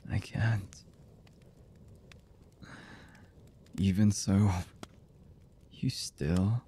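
slow, halting lover's voice with long pauses; hesitant, emotional confession. Acted. Lover confessing horrible deeds and love to the beloved, halting and emotional. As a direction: confess haltingly, one fragment at a time. Voice actor's romantic audio roleplay.

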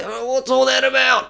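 high whingeing moan, strained; strained, high moany whinge. Shown as a demonstration. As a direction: sound constipated with complaint. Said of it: "Why do they sound constipated?".

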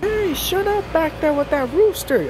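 a fed-up neighbor hollering over the fence; distant neighbor yelling. Acted. Neighbor yells about the rooster, slow and distant.

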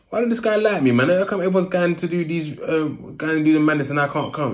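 high, whiny complaint; whiny younger self. Retold or imitated.

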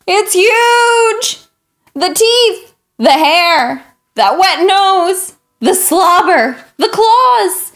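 mild mock fright; alarmed. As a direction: sound rattled by the huge dog. Pigeon alarmed by big dog.

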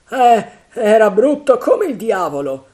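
high, loud cry of disgust; horrified shudder. A narrator's reading. Third pirate recalling Flint, horrified. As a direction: recoil loudly at the memory. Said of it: "con un moto di raccapriccio".

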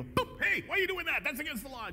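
high, indignant Pharisee voice; mock-indignant Pharisees challenging Jesus' disciples.

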